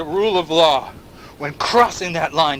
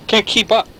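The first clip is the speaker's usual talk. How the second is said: higher and louder, pressing; insistent; 6 semitones higher, 3 dB louder than usual. Acted. Narrator repeating the point, louder and insistent. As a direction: push it up, insistent. Repeated phrase, two takes.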